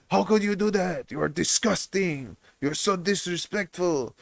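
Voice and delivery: mock outrage, imitating an offended stepfather; Jefferson. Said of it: "he got so mad at me".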